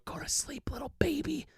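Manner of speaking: menacing lullaby whisper; hushed, hissed mock lullaby. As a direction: whisper it right into the ear while choking him. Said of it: "I whisper in his ear".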